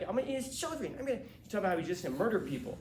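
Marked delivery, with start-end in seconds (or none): 0.0-1.2 hammed-up boxer threat, much higher; mock menacing brag, pitched way up; imitating Mike Tyson. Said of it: "He's like".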